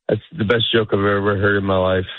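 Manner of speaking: low monotone compliment, no amusement; deadpan praise. Acted. Comic answering another's joke, deadpan praise. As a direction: praise it as if you hate them. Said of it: "It sounds like I hate you".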